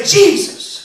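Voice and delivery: calls out Jesus high and loud. Shown as a demonstration. Singer leads church to call out loud. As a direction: belt out the name Jesus. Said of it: "Now say".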